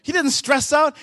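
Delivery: ordinary talk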